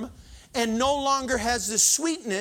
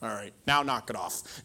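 gruff hug; 11 semitones lower, 5 dB quieter than usual. Retold or imitated. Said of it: "I'll be like".

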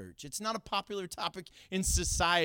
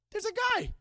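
high-pitched startled exclamation; retold surprise, voice jumping way up; acted startled sighting. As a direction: pipe up suddenly in mock alarm.

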